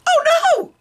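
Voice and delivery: very high, loud cry of alarm; yelling mother. Acted. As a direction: shriek it in horror. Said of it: "yelled the mother".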